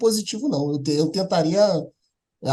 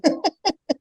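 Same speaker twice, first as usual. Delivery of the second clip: short laugh; 8 semitones higher than usual. Real, not acted. Laughs at his own story.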